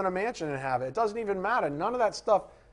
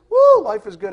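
loud whooping woo, much louder; mock whoop; 6 semitones higher, 15 dB louder than usual. Preacher imitating an overjoyed new believer, loud mock whoop. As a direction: hoot it like a giddy new convert. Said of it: "attitude the rest of your life".

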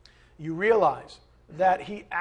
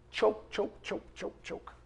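choking sounds; 9 dB quieter, slower than usual. Pretend. Mimics seed choking out.

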